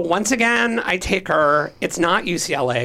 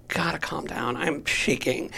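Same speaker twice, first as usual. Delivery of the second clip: soft, lowered voice; subdued self-calming; 2 semitones lower, 8 dB quieter than usual. Retold or imitated. Teller recalling shaking before the police, subdued self-calming. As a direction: drop the volume to steady yourself. Telling police they are shaken.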